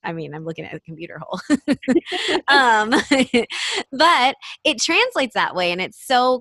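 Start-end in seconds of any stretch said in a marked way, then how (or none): 1.5-2.4 high, bright burst of laughter. Real, not acted. Laughing at computer hole joke. 3.0-3.9 laugh. Real, not acted. Laughing continues.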